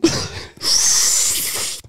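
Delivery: drawn-out sucked-in breath; imitated long hissy inhale; sucking in air. Clerk reacting to entry-level pre-workout, sucking in air. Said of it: "and he just sucks in".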